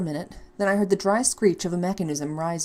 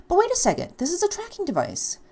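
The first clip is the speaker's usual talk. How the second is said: it just clicked, light up; sudden realization; 13 semitones higher than usual. Acted. Ashley realizing the tracker can save her, excited.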